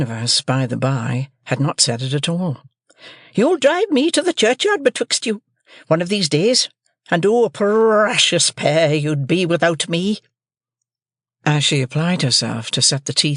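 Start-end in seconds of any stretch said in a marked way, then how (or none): 3.3-10.2 shrill high shrewish scold; scolding sister. A narrator's reading. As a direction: Mrs Joe scolds young Pip, high and shrill.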